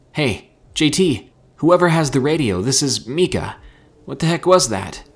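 high, loud, alarmed hailing; urgent radio call. A narrator's reading. Mika hails JT's group after screams, loud and urgent.

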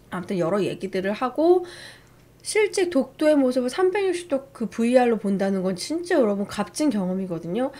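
normal talking voice

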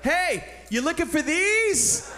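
high, drawn-out excited call; can't wait. Retold or imitated. Hiker returning found keys to the woman, bursting with excitement. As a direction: call out triumphantly, stretching the words. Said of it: "I couldn't wait".

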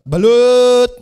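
balut vendor call. Retold or imitated. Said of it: "Pansinin niyo yung magbabalot".

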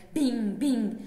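two ringing bings, each falling in pitch; loud imitated bing. Poet falling off the shelf, loud imitated impacts. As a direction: punch out the bings like bumps. Imitates bouncing falls in poem.

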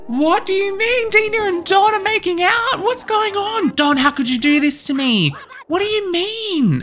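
a betrayed wife shrieking in shock; high shocked wail at her husband; shocked and confused. Acted. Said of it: "she's literally so confused".

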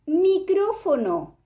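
"micrófono" drawn out slowly; stretched reveal. Shown as a demonstration. Teacher revealing the answer word slowly. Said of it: "formamos la sílaba mi, como".

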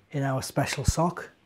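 plain reading voice

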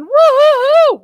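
high, loud cheering woo-hoo; a real excited woo-hoo; excited whoop. Real, not acted. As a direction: whoop to greet the viewers. Cheering as viewers join in.

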